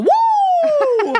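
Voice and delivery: very high, drawn-out "woo"; celebratory whoop. Retold or imitated. Dice roller re-enacting her perfect roll, celebratory whoop. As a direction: break into a high mock victory whoop.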